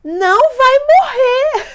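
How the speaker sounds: high, loud mock-soothing nurse. Nurse at the door calming the mother, mock-soothing and high. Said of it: "Uma enfermeira aparece na porta e fala assim".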